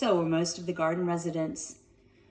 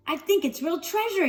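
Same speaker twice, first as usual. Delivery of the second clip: excited shriek; 10 semitones higher than usual. Acted. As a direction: squeal it out with glee. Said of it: "he shrieked".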